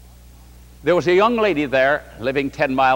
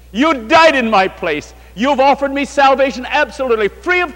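raised, high, loud pleading prayer to the Lord; fervent preaching prayer; 5 semitones higher, 5 dB louder than usual. Acted. Preacher leading the congregation in prayer to the Lord, impassioned and loud. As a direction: pour the prayer out at full preaching force.

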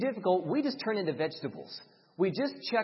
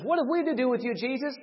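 a church-service outburst, louder and higher; demoniac outburst; 6 semitones higher, 5 dB louder, faster than usual. Retold or imitated. Demoniac interrupting the church service, loud and higher. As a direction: cry out as the possessed man. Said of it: "A demoniac stands up … and says".

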